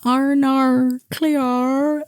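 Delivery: exaggerated Aussie impression, very slow; mock Australian accent. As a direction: stretch every vowel into cartoon Australian. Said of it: "Australian accent".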